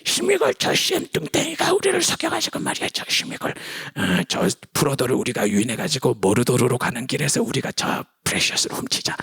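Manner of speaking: scheming creature, raspy half-whisper; Gollum. Acted. Creature plotting with itself to rob Frodo, conspiratorial rasp. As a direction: plot the theft in a creepy creature voice. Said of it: "스미골이 막 괴로워합니다".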